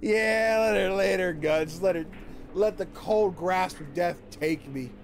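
loud, slow, hammed-up plea; mock dramatic. Streamer hams up a plea for death to chat, slow and loud. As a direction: beg for death in mock melodrama.

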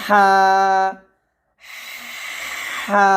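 হা with a thin stream of air first; breathy ha. Shown as a demonstration. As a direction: push a thin breath, then হা. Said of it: "এভাবে।".

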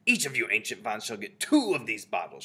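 loud witch leader's announcement; shouted. A narrator's reading. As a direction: call it out firmly to the crowd. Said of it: "she shouted".

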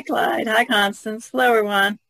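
normal talking voice

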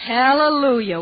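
loud, drawn-out hallelujah; joyful shout; jubilant cheer. Acted. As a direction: celebrate arriving at last. Cheering on arrival.

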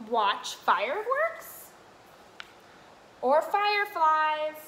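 sing-song picture book read; reading to children. Acted. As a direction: read the rhyme brightly to little ones. Continues reading rhyming book.

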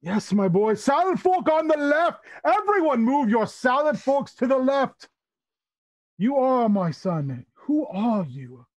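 high-pitched, delighted proclamation easing into slow wonder; exuberant Tabaxi uncle. Acted. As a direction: announce it grandly to the whole table. Tabaxi host directs the table.

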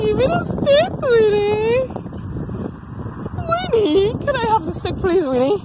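high begging voice to a dog; high-pitched doggy wheedling; playful pleading. Real, not acted.

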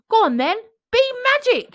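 loud, high shout; shouting at beans. A narrator's reading. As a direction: yell at the beans to work. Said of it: "he shouted at them".

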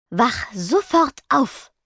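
slow, firm self-command. A narrator's reading. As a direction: order yourself to wake up. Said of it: "befahl sie sich". (langsamer, strenger Befehl an sich selbst)

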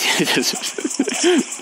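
airy, unvoiced high laugh; breathless laugh. Real, not acted. Streamer laughing at his teammate, airy wheeze.

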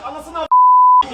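long drawn-out angry yell; furious yell. Real, not acted. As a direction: explode at the screen. Furious at a goal.

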